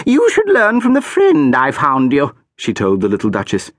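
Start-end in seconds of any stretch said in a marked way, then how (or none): 0.1-2.3 high, loud, lofty instruction; haughty archduchess. A narrator's reading. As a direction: lecture from the doorway. Archduchess lectures the child.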